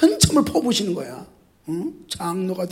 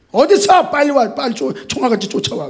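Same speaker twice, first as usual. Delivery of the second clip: pretend phone scolding; 5 semitones higher, 4 dB louder than usual. Pastor phoning absent member.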